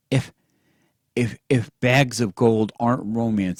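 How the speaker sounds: loud stammering shout. A narrator's reading. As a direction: burst out, tripping on the words. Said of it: "he shouted".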